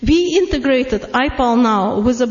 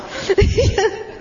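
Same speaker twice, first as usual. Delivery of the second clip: light laugh; 8 semitones higher than usual. Real, not acted. Laughs at her own story.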